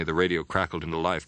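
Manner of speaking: usual speaking voice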